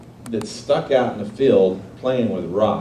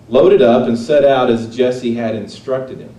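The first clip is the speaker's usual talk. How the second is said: ordinary talk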